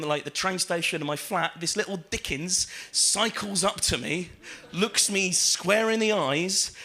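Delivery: ordinary talk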